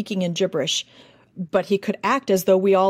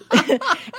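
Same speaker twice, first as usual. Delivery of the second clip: burst of laughter; 13 semitones higher than usual. Real, not acted. Laughing at the miracle.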